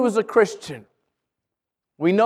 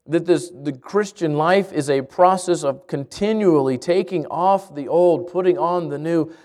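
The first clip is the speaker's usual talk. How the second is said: lower, hushed prayer voice; 5 semitones lower than usual. Acted. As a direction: pray it gently to the congregation. Pastor prays before the message.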